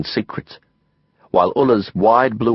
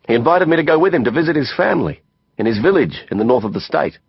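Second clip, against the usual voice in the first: plain reading voice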